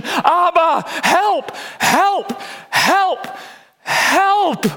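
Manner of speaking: high, desperate plea in repeated bursts; desperate cry. Acted. Believer crying out to Abba for help, desperate cry. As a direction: cry out for help at the top of your range. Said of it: "cry out in desperation".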